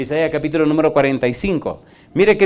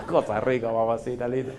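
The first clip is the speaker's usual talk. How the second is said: playful laugh; 4 semitones lower, 10 dB quieter than usual. Real, not acted.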